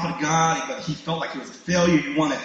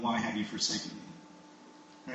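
very soft, low, slow lament; quiet plea of the forsaken; hushed cry to God; 6 semitones lower, 15 dB quieter than usual. Acted. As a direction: say it slowly, almost under the breath. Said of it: "cry out to God".

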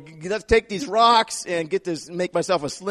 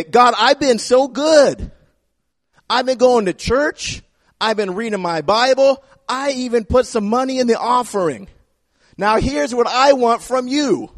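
high, loud, slow and smug, bragging to God; mock entitled churchgoer praying; smug self-righteous prayer; 6 semitones higher, 4 dB louder than usual. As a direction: list your good deeds like you're owed a reward.

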